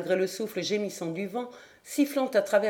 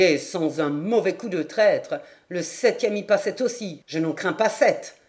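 put-on low, forceful drunken boasting; lower-voiced brawler spoiling for a fight; belligerent drunkard; 3 semitones lower than usual. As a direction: challenge the room like a tough drunk.

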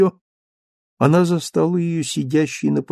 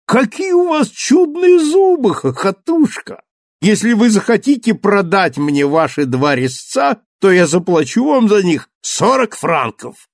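loud, high huckster's call; charlatan dentist; 6 semitones higher, 3 dB louder than usual. Acted. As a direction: hawk it like a fairground quack. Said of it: "вдруг вскричал". (зазывала-шарлатан)

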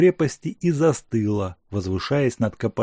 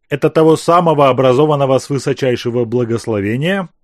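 wry smirk; 2 semitones higher, 7 dB louder, faster than usual. Acted. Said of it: "Криво усмехнулся Азеф".

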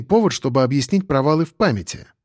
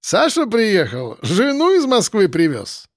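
put-on higher, slow joking Caucasian accent; light Caucasian accent; 7 semitones higher than usual. A narrator's reading. Roommate teases Sasha, high light Caucasian accent. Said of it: "небольшой, но довольно сочный акцент".

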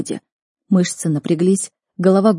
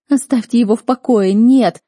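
raised, high desperate cry building louder; shouting ever louder; 5 semitones higher, 7 dB louder than usual. A narrator's reading. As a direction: cry out in protest, growing louder. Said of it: "кричала я всё громче".

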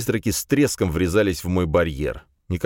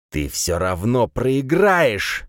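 spiteful; 5 semitones higher, much slower than usual. A narrator's reading. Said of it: "скривив губы в злобной улыбке, процедил некромант".

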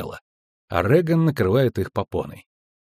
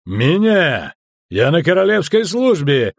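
loud, outraged assertion of rank; indignant military outburst; angry bark; 6 semitones higher, 5 dB louder than usual. A narrator's reading. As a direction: bellow with wounded authority. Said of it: "рявкнул он".

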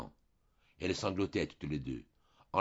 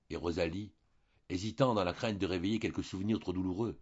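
ordinary delivery

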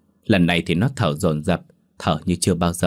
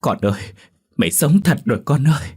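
higher, louder cry of disbelief; a mother sobbing with joy over her daughter; stammering joy; 6 semitones higher, 4 dB louder than usual. A narrator's reading. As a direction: cry out that the child lives. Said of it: "ú ớ".

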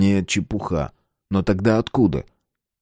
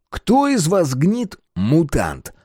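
slow menacing; 8 semitones higher, much slower than usual. Acted. Adamov threatening interrogation.

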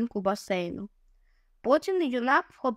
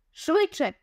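shout; 6 semitones higher than usual. A narrator's reading. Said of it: "крикнув Дже".

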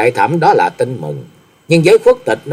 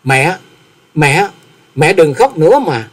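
put-on mildly raised, pleading child's call; crying out; 5 semitones higher, slower than usual. A narrator's reading. Son begs his crying mother to stop, mildly pleading. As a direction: plead with a touch of distress. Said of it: "lớn tiếng kêu lên".

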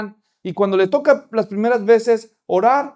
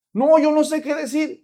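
put-on much higher child voice protesting; little girl; 7 semitones higher than usual. Little girl tells the pastor she can't pray, high child voice. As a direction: squeak out a scared refusal.

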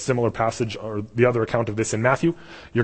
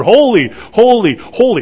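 much higher and louder, crying out; yelled proclamation; 10 semitones higher, 10 dB louder than usual. Retold or imitated. Seraphim yelling out God's holiness, loud proclamation. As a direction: call it out at full voice, like a chant of awe. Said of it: "they constantly yell out".

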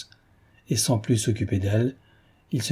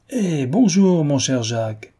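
joyful hail; 5 semitones higher, slower than usual. Acted. Father greeting the returning friend, joyful call. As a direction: boom out a hearty welcome. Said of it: "cria tout à coup la grosse voix joyeuse".

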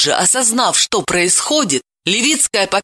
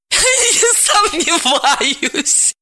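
laughing along; 13 semitones higher, much slower than usual. A narrator's reading. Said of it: "вторила ему".

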